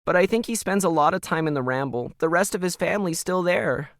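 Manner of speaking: a frightened daughter blurting bad news to her mom; upset teen daughter. A narrator's reading. Emily voiced in read story.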